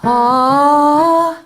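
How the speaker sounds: high thin "ah" with barely any sound; a breathy, weak "ah" with the cords apart; light chest sound. Shown as a demonstration. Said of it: "light chest where the person is singing".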